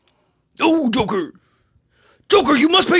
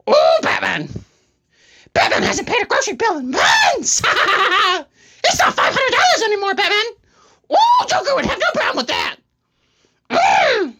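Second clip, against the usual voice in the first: manic Joker; 7 semitones higher than usual. Acted. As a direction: wail and taunt Batman as a gleeful Joker.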